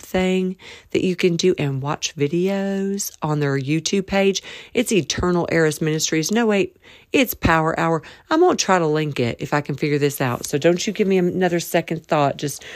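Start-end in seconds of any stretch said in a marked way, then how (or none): none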